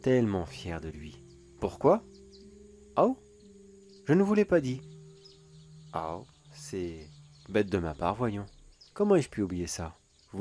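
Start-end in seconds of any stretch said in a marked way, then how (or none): 1.6-4.8 sharply higher, louder feigned astonishment; high, loud, theatrical fake surprise; mock surprise. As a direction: pretend you just remembered, hammed-up. Villain feigns surprise mid-monologue.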